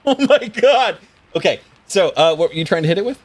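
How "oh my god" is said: high-pitched, laughing exclamation; amused disbelief. Real, not acted. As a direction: cry out through laughter, drawing it out. Reacts to player's critical miss.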